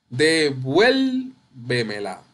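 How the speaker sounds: "Devuélvemela" very slowly with VUEL stretched; de-VUEL-vemela, leaning long on VUEL; stress on VUEL. Shown as a demonstration. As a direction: sound out "Devuélvemela", swelling on VUEL. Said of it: "Ahí lleva la tilde".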